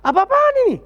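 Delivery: high, loud, outraged demand; the elder son bursting out; indignant outburst. Acted. Elder son at the party, shouting in outrage. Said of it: "Jadi dia tanya".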